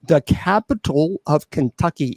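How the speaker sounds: ordinary delivery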